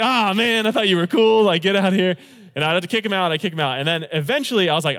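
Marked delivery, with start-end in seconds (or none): 0.0-2.2 put-on louder, higher whiny teen; disappointed skater kid. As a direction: let down, then telling him off. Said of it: "And they'd be like".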